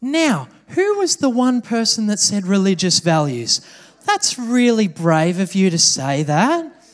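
high, loud, slow gushing praise; gushing teacher. Retold or imitated. Teacher singling him out before the class, loud gushing. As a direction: play up the teacher's public praise. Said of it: "she goes".

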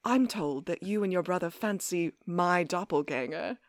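put-on soft, British-accented villain; quiet, smooth menace in a British accent; Klaus impression. Villain warning two men at the bar, British Klaus impression. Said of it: "a good Klaus, I would say".

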